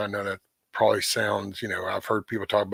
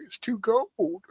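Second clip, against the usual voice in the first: high, drawn-out mock whine; complaining dog's voice; 12 semitones higher, slower than usual. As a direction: stretch it out high as the reluctant dog. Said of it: "it would be just like".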